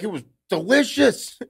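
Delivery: high, loud imitation of wired dad; caffeinated rave, hammed up; jacked up. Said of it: "like, a little jacked up".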